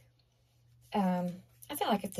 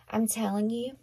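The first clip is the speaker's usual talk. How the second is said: ordinary talk, nothing marked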